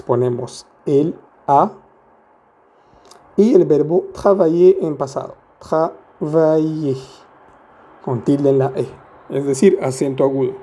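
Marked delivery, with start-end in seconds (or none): 5.6-7.0 slow, drawn-out French syllables. Shown as a demonstration. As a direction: break the word "Travailler" into slow syllables. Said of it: "¿Cómo se lee?".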